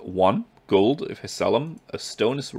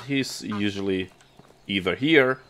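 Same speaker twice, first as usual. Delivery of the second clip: ordinary talk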